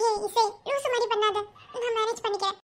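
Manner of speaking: high, soft, crying plea; tearful protest. Acted. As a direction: weakly beg a friend to stop. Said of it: "அழுதுட்டு இருக்க".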